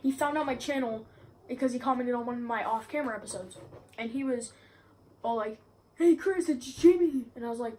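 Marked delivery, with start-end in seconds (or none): 5.9-7.3 high, loud imitated greeting; mock-eager greeting, pitched up; Jimmy. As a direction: call out an eager hello, mimicking someone.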